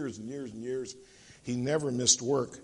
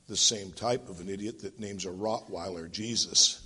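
low, deadpan parrot comeback; parrot retort; 8 semitones lower than usual. Acted. Parrot answering the scornful thief, low deadpan retort. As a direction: drop low and deliver it dryly. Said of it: "The parrot speaks yet again".

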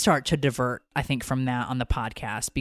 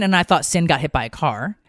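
normal talking voice

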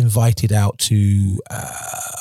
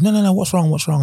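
high, worried no no no; alarmed concern; 10 semitones higher than usual. Retold or imitated. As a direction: girlfriend startled by his outburst, pitched up high. Girlfriend alarmed at his outburst.

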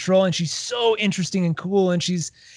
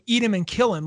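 usual speaking voice

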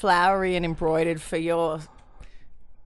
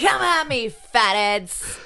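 mock taunt; 4 semitones higher, 4 dB louder, much slower than usual.

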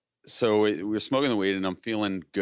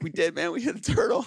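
high-pitched, panicky mock insistence; freaking out; 7 semitones higher than usual. Passenger insisting to the driver they hit a turtle, panicky. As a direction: insist frantically, voice climbing higher. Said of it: "I'm so freaking out".

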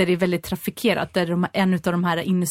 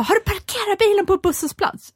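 much higher, exasperated retold sister; imitating her pitched-up outrage; angry disbelief; 12 semitones higher than usual. Imitating the angry sister's outrage over the bus-stop parking, high and exasperated. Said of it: "Och så var hon så här förbannad".

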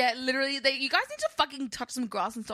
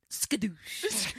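whispered movie-hero catchphrase; whispered skadoosh. Retold or imitated. Quoting Kung Fu Panda's ending, whispered. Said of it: "And he goes".

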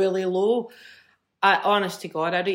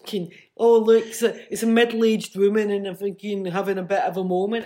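louder, raised mock-commentary voice; mocking dismissal; 4 dB louder than usual. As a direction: play a sneering critic dismissing her. Mocks the journalist's imagined dismissal.

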